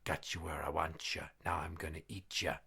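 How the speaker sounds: low, hushed ghostly threat; quiet menacing sing-out from the dark; spooky voice. Acted. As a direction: drop it low and soft, like a voice haunting a room. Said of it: "he hears a voice say".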